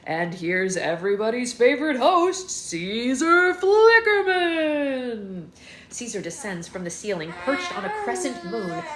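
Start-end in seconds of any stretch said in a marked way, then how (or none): 0.1-5.5 higher, loud and slow, drawn-out name; grand host introduction. A narrator's reading. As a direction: announce the star of the show. Host introduction, announcer style.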